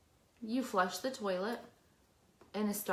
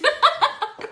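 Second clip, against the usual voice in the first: big laugh; 12 dB louder than usual. Real, not acted. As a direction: burst of high laughter. Laughing at own story.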